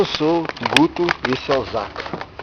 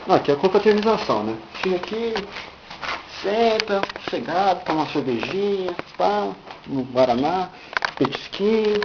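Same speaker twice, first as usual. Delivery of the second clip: high-pitched host voice; 7 semitones higher, 3 dB quieter than usual. Pretend. Showing hangout spot, host voice.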